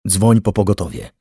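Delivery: lightly strangled, urgent order; choked voice. A narrator's reading. Man tells her to call an ambulance, choked. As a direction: force the words through a tight throat. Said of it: "powiedział zduszonym głosem".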